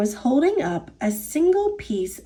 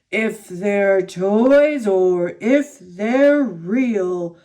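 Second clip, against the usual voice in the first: slow, booming giant chant; thunderous giant; 4 semitones lower, much slower than usual. A narrator's reading. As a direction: boom it out slowly, stretching each word like a giant. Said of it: "His thunderous voice boomed".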